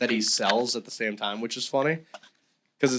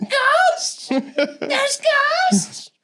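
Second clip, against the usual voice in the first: very high mock-panicked squeal; hammed-up high cry of alarm; anxious old person; 3 dB louder, much slower than usual. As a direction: imitate a frightened old person, pitched way up. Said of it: "old people experiencing anxiety".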